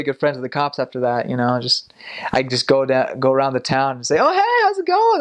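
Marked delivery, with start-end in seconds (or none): 4.2-5.2 very high-pitched acted hello; cheery greeting. Missionary greeting cop friends around town, high cheery hello. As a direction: sing out a hammed-up friendly greeting.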